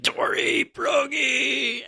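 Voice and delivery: freaking out, very high drawn-out shout; Zoro. Acted. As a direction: scream the names in mock panic. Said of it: "Zoro's really freaking out".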